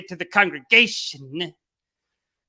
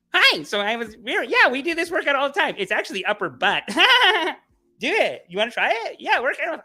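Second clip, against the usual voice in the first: put-on bubbly higher girly voice; hammed-up friendly gym girl; gym girl chatter; 10 semitones higher, 4 dB louder than usual. Girls at the gym chatting him up, bubbly and high. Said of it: "you talk to these people and they go".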